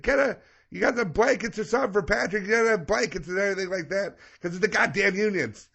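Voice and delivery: high-pitched, hammed-up nagging dad; imitated Italian dad. Dad asking daughter about blankets, hammed-up fussing. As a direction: fuss at her way up high.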